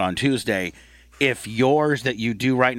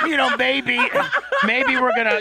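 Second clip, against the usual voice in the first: higher, talking through laughter; laughing. Real, not acted. Host reconsidering the story, laughing. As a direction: still chuckling as he backpedals.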